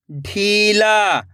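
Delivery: ढीला joined as one slow word; blended word. Shown as a demonstration. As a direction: read ढीला whole, falling at the end. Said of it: "खींच के पढ़ना है".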